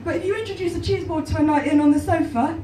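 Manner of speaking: ordinary talk, nothing marked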